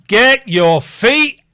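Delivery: slow, loud scolding shout; loud slow yell. Pretend. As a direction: bellow the order. Yelling at character.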